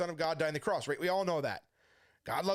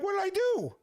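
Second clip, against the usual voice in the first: high-pitched retold panic; alarmed bewilderment; 11 semitones higher, 4 dB louder than usual. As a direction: blurt it high, baffled and defensive. Stranger suddenly cries on him.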